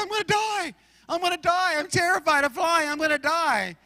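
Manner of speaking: imitating scared passenger. Pastor mimicking the frightened lady next to him, panicky. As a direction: mimic her panic on the plane. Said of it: "She was terrified".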